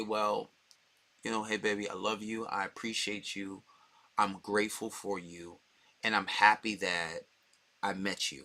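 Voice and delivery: soft, low, loving murmur; tender sweet talk. Acted. A boyfriend sweetly reassuring his girlfriend, soft and low. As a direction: ease it out gently, like telling her you love her.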